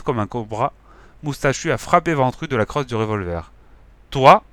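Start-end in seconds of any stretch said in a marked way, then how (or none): 4.1-4.4 loud sharp command; ordering. Acted. Gang leader ordering Ventru, loud. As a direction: bark the order. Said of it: "a ordonné le bigleux".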